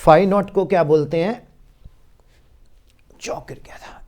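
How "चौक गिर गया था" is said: quick unvoiced whisper aside. Real, not acted. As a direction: whisper it under your breath. (फुसफुसाकर)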